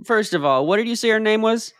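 ordinary talk

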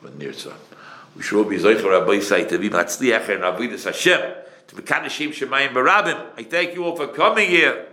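ordinary talk, nothing marked

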